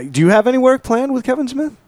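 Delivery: squeaky high-pitched question. Pretend. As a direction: pitch way up like a cartoon. Mock interview question in character.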